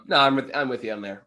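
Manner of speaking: normal talking voice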